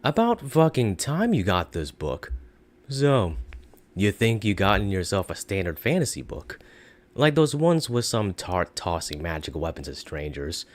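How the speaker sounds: put-on British accent, monotone with downward intonation; dry, bored British snark; snarky British narrator. Narrator greeting the reader, snarky monotone British accent. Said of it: "The narrator says with a bit of snark in his accent, a monotone, downward intonation".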